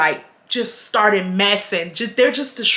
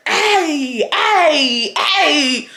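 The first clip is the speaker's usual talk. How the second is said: drawn-out party cheer, raised pitch; partying crowd; 5 semitones higher, much slower than usual. Retold or imitated. Imitating the party heard from the parked car, crowd cheer. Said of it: "I can hear".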